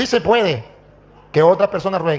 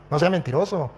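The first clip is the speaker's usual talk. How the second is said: ordinary talk, nothing marked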